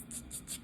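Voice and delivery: unvoiced ch clicking; whispered clicking noise; pincer clicks. Shown as a demonstration. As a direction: snap like crab pincers. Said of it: "tienen pinzas".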